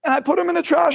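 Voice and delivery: normal talking voice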